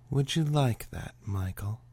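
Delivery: lightly voiced seductive purr; a hint of sweet seduction; sultry tease. Morgan luring Michael closer, lightly sultry. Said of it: "Her voice was as sweet as bird song".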